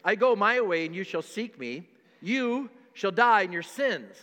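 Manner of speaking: loud, preached scripture; thundering scripture. Acted. Preacher reading Jesus's warning, thundering loud. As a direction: boom the verse out at the congregation. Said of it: "I keep going over this".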